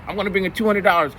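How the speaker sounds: put-on high, fast cocky kid voice; bragging student. Student bragging he'll slime the teacher, fast high cocky kid voice. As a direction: rattle it off like a boastful eleven-year-old. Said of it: "He just".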